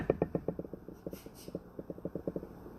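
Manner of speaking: long, soft breathy laugh; cracking up. Real, not acted. Author tickled by her cocaine joke, cracking up. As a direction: laugh helplessly, trailing into soft wheezes.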